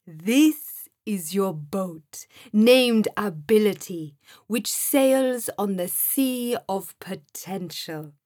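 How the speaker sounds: slow, stately voice with a smile; kindly Sam figure. A narrator's reading. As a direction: present it grandly and slowly, smiling. Figure Sam speaks in the story.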